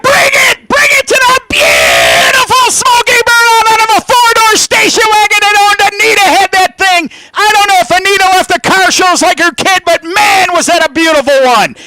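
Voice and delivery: shouted, very high-pitched race commentary; excited play-by-play. Real, not acted. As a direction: erupt over the burnout at full force. Live burnout call at full force.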